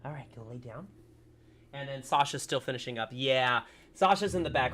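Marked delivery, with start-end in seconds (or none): 0.0-0.9 tender pet talk. Real, not acted. Cooing softly to the dog.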